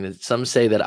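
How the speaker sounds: ordinary talk, nothing marked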